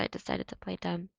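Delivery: ordinary delivery, nothing marked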